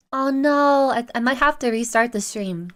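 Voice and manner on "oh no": ordinary delivery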